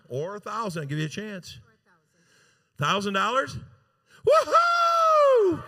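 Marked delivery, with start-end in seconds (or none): 4.3-5.6 a high, loud cheering whoop; real delighted shout; excited whoop. Real, not acted. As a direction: whoop for joy as the bid lands. Bid hits a thousand dollars.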